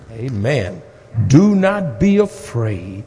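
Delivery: ordinary talk, nothing marked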